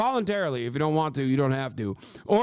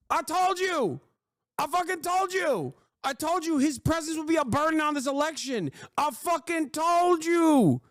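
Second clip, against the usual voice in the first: high, triumphant I-told-you; gloating; 9 semitones higher than usual. Real, not acted. Streamer gloating to chat about the election. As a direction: crow it high.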